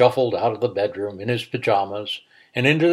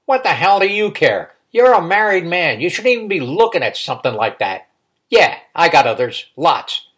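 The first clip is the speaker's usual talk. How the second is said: loud, higher, scornful; angry retort; 5 semitones higher, 6 dB louder than usual. A narrator's reading. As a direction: scold him for prying. Uncle snaps at nosy nephew.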